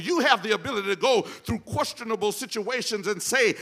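usual speaking voice

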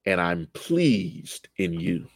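a father quietly pleased; tender voice of God. Retold or imitated. God's words, soft and slow.

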